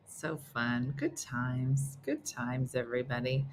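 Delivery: soft, low, drawn reminiscence; mock wistful sigh. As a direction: fade out fondly.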